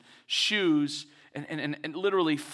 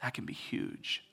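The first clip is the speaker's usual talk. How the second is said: hushed voice of God; 7 semitones lower, 9 dB quieter than usual. Retold or imitated. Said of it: "God looks at them and says".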